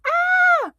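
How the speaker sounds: very high, loud shrieking ah; scariest scream. Acted. Girl scaring grandma, loud high clown shriek. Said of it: "I kept screaming".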